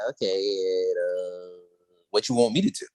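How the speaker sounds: low, hesitant acted reply; unimpressed. Grace answers visitors asking for help, unimpressed. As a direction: let it out low and unbothered. Retold Grace hearing their request.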